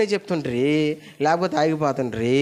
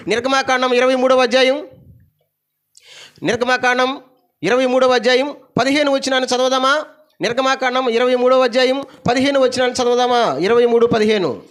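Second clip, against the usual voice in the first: higher and louder verse call; preaching call-out; 10 semitones higher, 5 dB louder than usual. Acted. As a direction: call out the chapter to the hall. (బిగ్గరగా వచనం ప్రకటన)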